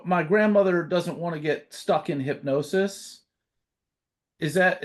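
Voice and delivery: usual speaking voice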